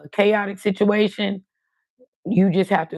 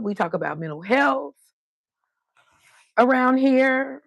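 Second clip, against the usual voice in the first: host intro; 4 semitones higher than usual. Acted. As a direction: sell the channel to viewers with energy. Channel intro, hosted delivery.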